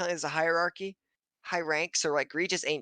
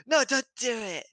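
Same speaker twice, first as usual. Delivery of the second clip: mock monitor voice; 8 semitones higher than usual. Monitor voiced in anime recap.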